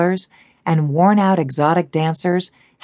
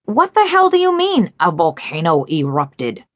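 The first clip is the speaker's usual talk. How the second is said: yelling. A narrator's reading. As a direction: blow up at him down the phone. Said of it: "his partner yelled".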